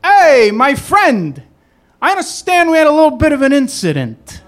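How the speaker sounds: husband on the phone buttering up the cab driver; smooth-talking husband. Retold or imitated. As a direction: schmooze the stranger like a fixer. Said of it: "I hear this voice say".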